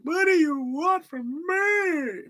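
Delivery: high, slow tearful wail; mock sobbing, drawn-out begging. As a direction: plead in hammed-up crying despair. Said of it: "It looked like [name] when he was like".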